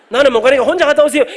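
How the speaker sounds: mock-outraged refusal, as if finally letting it out; imagined loud refusal. Imagined blunt refusal he wanted to give. (속마음을 터뜨리듯 크게 거절하며)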